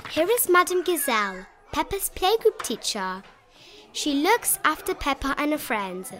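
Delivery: plain reading voice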